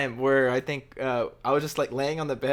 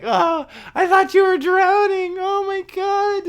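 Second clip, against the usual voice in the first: very high, slow, wailing mock panic; hammed-up terrified girlfriend voice; imitated panic; 8 dB louder, slower than usual. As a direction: girlfriend who thinks he drowned, hammed-up high wail. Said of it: "like, panicking".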